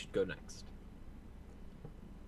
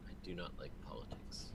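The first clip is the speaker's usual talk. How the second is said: hushed, voiceless complaint; whispered grumble; 6 semitones lower, 12 dB quieter than usual. Acted. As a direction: mutter it so the town won't hear. Grumbling aside to party.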